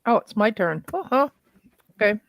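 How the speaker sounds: normal talking voice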